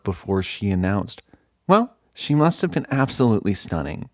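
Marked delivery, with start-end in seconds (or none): 1.7-4.1 flirty, teasing. Acted. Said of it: "With a wink, she smirked".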